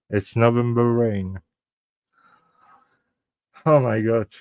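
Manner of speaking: normal talking voice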